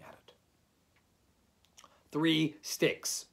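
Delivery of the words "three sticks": quick, crisp answer; prompt. A narrator's reading. Rabbit answers Eeyore's question promptly. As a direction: answer at once, a little louder. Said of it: "said Rabbit promptly".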